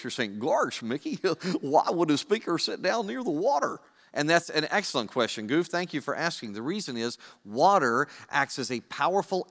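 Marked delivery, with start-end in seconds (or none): 0.4-3.8 goofy cartoon voice with a silly chuckle; hammed-up cartoon impression, chuckling mid-line. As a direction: swoop up and down like a dopey cartoon character. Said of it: "In your, uh, goofy voice".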